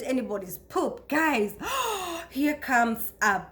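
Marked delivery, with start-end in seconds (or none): 1.6-2.2 shocked gasp. Real, not acted. Shocked by caregiving memory.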